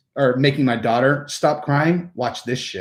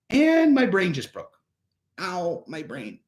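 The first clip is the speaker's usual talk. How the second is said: mock baffled child; 4 semitones higher, 5 dB quieter than usual. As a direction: play a kid's overloaded brain, high then fading. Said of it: "They're like".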